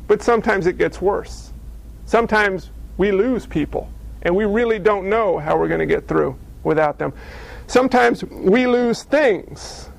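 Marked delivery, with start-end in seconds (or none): none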